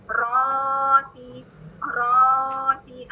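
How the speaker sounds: रोटी with a long held ro; slow recitation demo. Shown as a demonstration. Teacher shows the ro sound slowly. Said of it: "आरे डब्ल्यू रो वाली sound".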